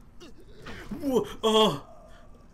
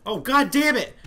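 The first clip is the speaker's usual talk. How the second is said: normal talking voice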